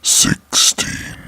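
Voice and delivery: drawn-out, growling horror announcer; spooky countdown voice. Acted. Narrator announces the next creepy story, spooky countdown voice. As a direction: boom the number out long and eerie.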